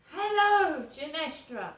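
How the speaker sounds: high drawn-out Hello, then Genestra; mum's sing-song greeting. Retold or imitated. Imitating mum greeting her daughter on the phone, high sing-song. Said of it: "Not, "Hello, Crystal".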